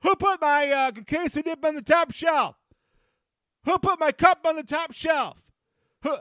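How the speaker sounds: high, loud, annoyed shouting; griping loudly at home about the top shelf; exasperated yell. Retold or imitated. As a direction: holler like a short guy who can't reach. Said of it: "It's like".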